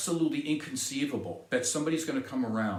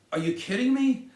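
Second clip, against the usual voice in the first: higher and louder, in disbelief; incredulous outburst; 8 semitones higher, 5 dB louder than usual. Real, not acted. Vlogger ranting at vaccine refusers, incredulous outburst. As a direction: throw it out like you can't believe it.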